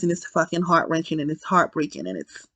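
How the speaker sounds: trailing off, overcome with sadness; heartbroken fading. Real, not acted. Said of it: "it killed me".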